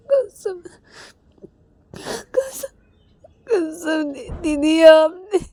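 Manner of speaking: high, breaking sobbed words, slow; crying. Retold or imitated. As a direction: choke the words out through tears. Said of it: "Ravi ये बोलकर रो देता है". (रोते हुए टूटी आवाज़ में)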